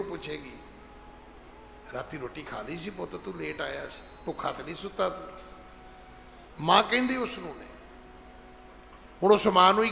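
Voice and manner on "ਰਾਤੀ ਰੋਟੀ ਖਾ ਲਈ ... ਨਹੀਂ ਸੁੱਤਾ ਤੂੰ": soft, tender motherly question; a hint of a worried mother; caring mother. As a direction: ask gently like an old mother. Mother asks son about dinner.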